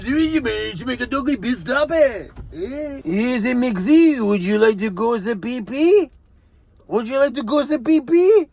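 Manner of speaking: hammed-up, badly faked French accent; mock French accent. Offering the dog a pit stop in a joking French accent. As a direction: put on a silly French accent. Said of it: "Should I do that in my French accent?".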